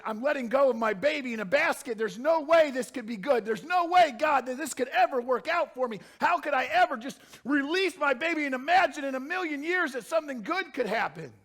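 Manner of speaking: high, loud, desperate cry to God; anguished mother's plea. Acted. Mother giving up her baby, pleading loudly.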